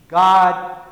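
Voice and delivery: a sudden loud plea to heaven; a beaten-breast cry of God; anguished cry. Acted. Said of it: "beating his breast and saying".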